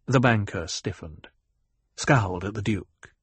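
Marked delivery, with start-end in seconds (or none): none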